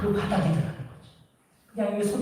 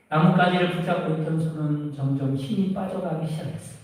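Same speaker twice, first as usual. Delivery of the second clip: ordinary talk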